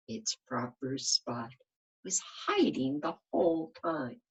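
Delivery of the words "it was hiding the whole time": usual speaking voice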